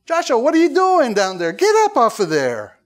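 high, loud mock scolding; sarcastic Lord. God scolds Joshua lying on the ground, sarcastic. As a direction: act out God's sarcastic, impatient rebuke. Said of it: "it sounds kinda like the Lord was sarcastic".

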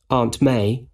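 usual speaking voice

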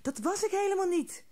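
high scared little voice, a touch shaky; trembling. A narrator's reading. Frightened hare denies hooting to grumpy friend, nervous high voice. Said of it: "trilde Haasje".

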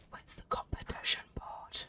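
breathy whispered question; hushed, voiceless whisper. Real, not acted. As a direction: whisper it as if to yourself. Searching quietly in the game.

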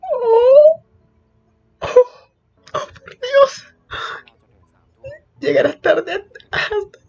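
very high crying whimpers of delight; whimper, overcome with joy; swooning whimper. Real, not acted. Fan swooning at the romantic scene, high crying whimper.

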